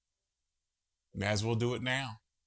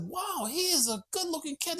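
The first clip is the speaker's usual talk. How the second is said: much higher, impressed; retold admiration. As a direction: marvel at the handsome cat. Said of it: "I said".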